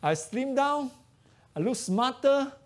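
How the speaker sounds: usual speaking voice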